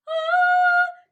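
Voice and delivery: very high, loud falsetto ah. Shown as a demonstration. Teacher demonstrating falsetto on ah, very high. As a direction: float the ah way up in falsetto. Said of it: "pero vas a tirar un poco de aire".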